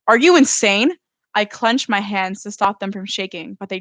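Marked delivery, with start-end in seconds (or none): 0.1-1.0 angry. A narrator's reading. Daughter fighting with mother.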